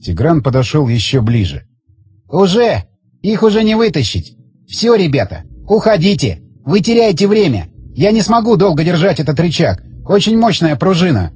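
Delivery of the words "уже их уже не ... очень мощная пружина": higher-pitched character voice; raised, insistent man's voice; tense bomb holder. Acted. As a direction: explain it with strained urgency, pitched up. Voices Zagorsky urging friends away.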